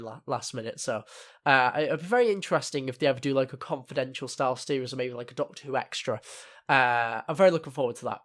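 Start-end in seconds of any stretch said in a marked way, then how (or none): none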